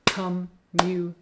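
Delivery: 'Communication' split into five separate syllables; level, evenly spaced syllables on 'Communication'; broken into syllables. Shown as a demonstration. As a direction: say 'Communication' syllable by syllable, with clear gaps. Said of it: "broken up into five syllables".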